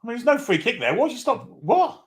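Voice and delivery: high, loud outrage at the call; can't believe the decision; indignant protest. Real, not acted.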